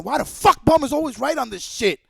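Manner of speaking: ordinary talk, nothing marked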